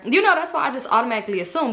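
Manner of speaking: ordinary delivery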